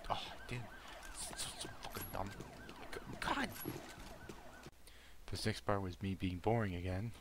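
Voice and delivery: ordinary delivery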